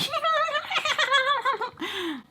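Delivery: long, very high mock cackle; imitated cackling. Emily's group laughing at the angry boyfriend, imitated high cackle. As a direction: cackle like the girls piling on.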